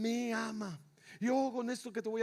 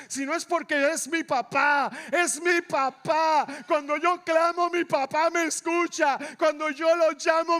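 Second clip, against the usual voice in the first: loud, rising preacher's cry; full-force preaching; 5 semitones higher, 8 dB louder than usual. Real, not acted. Preacher to congregation about his Father listening, full-force preaching.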